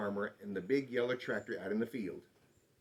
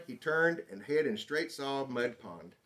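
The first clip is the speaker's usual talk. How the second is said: ordinary delivery, nothing marked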